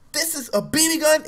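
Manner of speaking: high-pitched, outraged questioning; mad cop disbelief. Retold or imitated. Cop answering the boy's excuse, imitated angry disbelief. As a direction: throw it back in angry disbelief. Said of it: "the cop was so mad".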